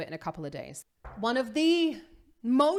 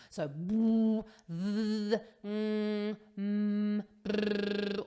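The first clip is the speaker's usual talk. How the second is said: hum, then buzz the lips; humming and lip trill; much slower than usual. Shown as a demonstration. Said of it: "semi-occluded vocal tract exercise".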